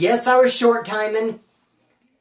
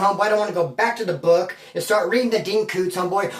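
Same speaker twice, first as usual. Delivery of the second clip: normal talking voice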